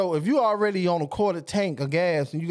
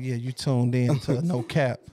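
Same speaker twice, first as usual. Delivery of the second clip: slow, low late-night DJ voice; sexy radio voice; 6 semitones lower, slower than usual. Pretend. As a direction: purr it like a smooth night host. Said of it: "My sexy voice came on".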